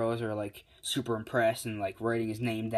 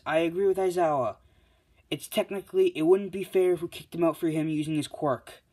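high, raised voice; acted referee, pitched up; referee's ruling; 6 semitones higher, 4 dB louder than usual. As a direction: declare it loudly from up high in the voice. Referee gives her ruling aloud.

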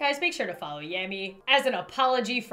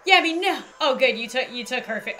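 normal talking voice

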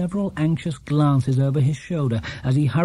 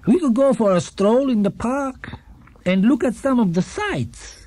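put-on higher, gentle old man's voice; kindly old shopkeeper; 8 semitones higher than usual. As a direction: play a cheerful elderly shopkeeper. Gruber continues.

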